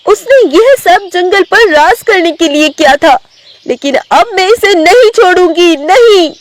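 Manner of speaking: high-pitched, determined tigress; vengeful tigress. Acted. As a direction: vow revenge in a high character voice. (ऊँची आवाज़ में बदले पर उतारू बाघिन)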